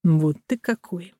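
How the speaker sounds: soft, restrained remark; softly sizing someone up; said quietly. A narrator's reading. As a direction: keep it hushed and measured. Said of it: "сказал тихо".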